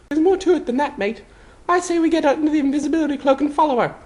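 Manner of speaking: put-on high boyish voice, quick and urgent; high young boy, fast conspiratorial plan; determined Harry. A narrator's reading. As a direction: push your mate to act now.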